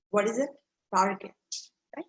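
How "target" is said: Target with a hard G; hard G in the middle of Target; correct pronunciation. Shown as a demonstration. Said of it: "this word is pronounced as".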